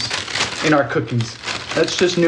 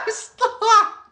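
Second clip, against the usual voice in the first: usual speaking voice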